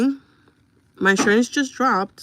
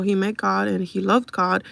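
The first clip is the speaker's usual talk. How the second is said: ordinary talk, nothing marked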